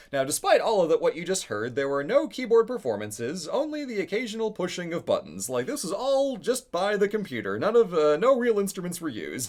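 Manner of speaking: lightly voiced old ad announcer; retro announcer. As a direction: present it like a vintage commercial. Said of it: "he's like".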